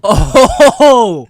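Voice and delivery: high, loud "oh" with a laugh; big drawn-out oh of recognition; loud realization. Real, not acted. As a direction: cry out as it dawns on you. Sudden recognition of gamer name.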